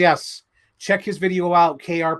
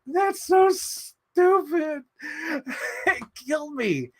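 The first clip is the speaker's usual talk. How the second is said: high, slow words through laughter; laughing; 12 semitones higher, much slower than usual. Real, not acted. Telling the audience about the trailer, laughing hard. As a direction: squeeze it out while cracking up. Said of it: "I was like".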